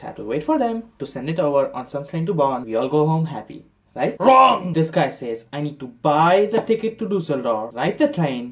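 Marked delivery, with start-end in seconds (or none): 4.2-4.7 high, loud buzzer-like shout; hammed-up blast of a verdict; emphatic reversal. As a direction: shoot the hope down loudly. Loud comic reversal of hopes.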